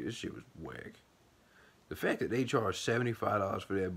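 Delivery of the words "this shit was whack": much softer, lower, dismissive mutter; muttered disgust. Retold or imitated. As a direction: mutter it under your breath, disgusted. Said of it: "I'm just like".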